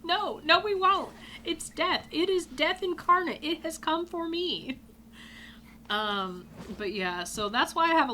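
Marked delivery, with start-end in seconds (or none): 0.0-4.8 high, melodramatic dread; panicked doom. Retold or imitated. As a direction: protest in hammed-up terror of the monster. Re-enacts doomed panic to friend.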